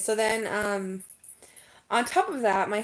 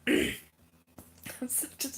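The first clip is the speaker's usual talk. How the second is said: whispered confession; sheepish whispered self-blame; guilty whisper; 5 semitones higher, much slower than usual. Real, not acted. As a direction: hush it like a guilty secret. Confessing embarrassing laugh.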